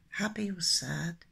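ordinary delivery

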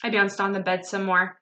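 ordinary delivery, nothing marked

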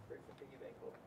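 breathy whisper of the question. Retold or imitated. Teacher whispers a student's question to himself. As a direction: softly relay what was asked.

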